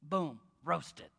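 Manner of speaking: mild mock Michael Scott gloat; Michael Scott catchphrase. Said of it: "what he would say".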